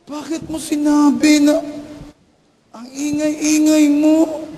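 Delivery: high, slow scold; scolding mother. Retold or imitated. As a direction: drag out the blame. Mother scolds loud child. (mabagal na pagalit na nanay)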